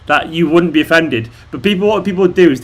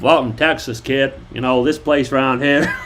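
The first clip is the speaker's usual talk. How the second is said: put-on Texan drawl; Texas accent; 3 semitones lower, 3 dB quieter than usual. Shown as a demonstration. Doing American accents for viewers, Texan accent. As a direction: play a Texan greeting a newcomer. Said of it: "Apparently the Texas is good".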